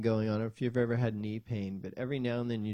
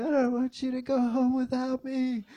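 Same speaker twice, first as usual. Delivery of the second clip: high, sustained mock wail; bawling little kid; 13 semitones higher, 4 dB louder than usual. Son on trail begging dad, imitated bawling. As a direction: imitate a toddler wailing through the words.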